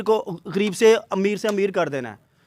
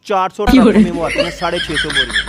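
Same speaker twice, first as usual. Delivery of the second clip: excited boast; 7 dB louder than usual. Real, not acted. Boasting loudly, laughing.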